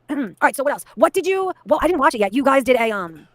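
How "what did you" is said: ordinary talk, nothing marked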